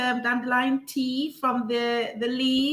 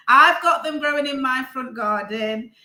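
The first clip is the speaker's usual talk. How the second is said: ordinary delivery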